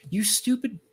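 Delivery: playful mock fury; mock exasperated outburst. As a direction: scold him like a fan yelling at the page. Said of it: "I'm like".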